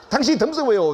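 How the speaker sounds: high-pitched mock retort to a wife; acted exasperated retort. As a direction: throw it back, hammed-up and high. Husband retorts to crying wife. (높게 받아치는 짜증 섞인 흉내)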